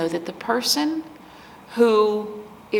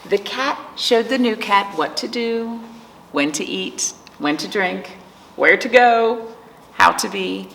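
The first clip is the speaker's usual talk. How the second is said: put-on reading to children. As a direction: let each page breathe for the little ones. Reads picture book to children.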